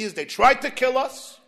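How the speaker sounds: ordinary talk, nothing marked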